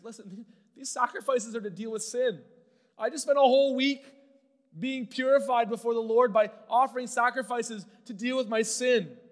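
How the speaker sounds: Aaron crying out to Moses in anguish; desperate plea. Acted. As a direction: beg to be understood, voice rising. Aaron pleading his case to Moses.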